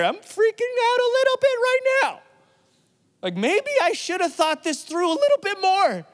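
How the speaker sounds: mock panic. Preacher imagines being Peter after Jesus' words, mock panicked and high. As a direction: play it up like a nervous disciple.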